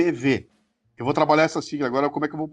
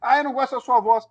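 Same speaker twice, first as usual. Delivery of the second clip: higher, whining complainer voice; mock the complaining listener; a hint of a whiny critic; 7 semitones higher than usual.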